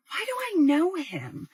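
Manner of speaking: high, drawn-out puzzled wondering. Retold or imitated. Puzzled woman spots a familiar man.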